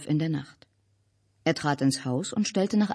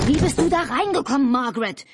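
loud, high-pitched shout; high outraged yell; 8 semitones higher, 5 dB louder than usual. A narrator's reading. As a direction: scream the question at her. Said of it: "schrie Albert".

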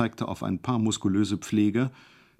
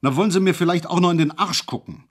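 loud, higher, fast outburst; bellowing; 7 semitones higher, 5 dB louder, faster than usual. Acted. Hejula bellows at the doctor with the torch, loud and fast. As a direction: explode at him, fast and loud. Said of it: "und brüllte".

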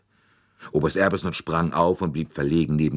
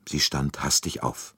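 plain reading voice

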